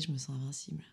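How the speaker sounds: ordinary delivery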